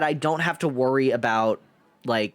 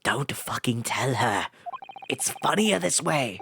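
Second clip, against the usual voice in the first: conspiratorial whisper; 4 semitones lower than usual. Real, not acted. Streamer hoping nobody tells Susato, conspiratorial whisper.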